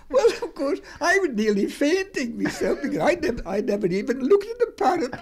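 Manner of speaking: high, laughing through the words; can barely speak for laughing; laughing while talking. Real, not acted.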